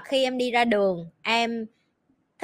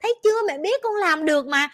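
higher, gushing put-on praise; fake cheering; 7 semitones higher than usual. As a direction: hide the irritation with sweetness. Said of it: "giả dạng mà cổ vũ con".

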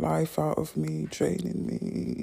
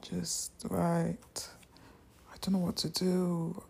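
usual speaking voice

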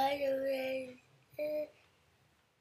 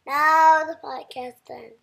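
shouting; 16 dB louder than usual. Real, not acted. Child loudly announces ending.

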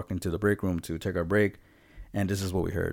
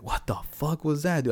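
high, mildly mocked voice of the coworker; a hint of a freaked-out friend; spooked coworker; 8 semitones higher than usual. Coworker asking about the noise, high spooked voice.

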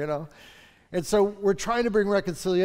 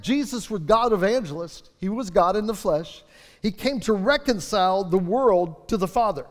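ordinary talk, nothing marked

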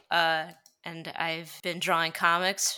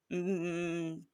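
ordinary talk, nothing marked

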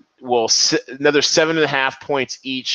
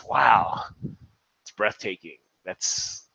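hushed, softer, then whispered; breathless awe; 6 semitones lower, 6 dB quieter, slower than usual. Real, not acted. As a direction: lose your breath in awe. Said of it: "let me compose myself".

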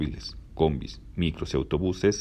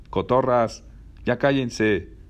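raised, higher-pitched telling-off; annoyed scolding; 7 semitones higher, 3 dB louder, much slower than usual. Acted. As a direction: call out crossly to noisy chatterers. Passengers scold laughing girls.

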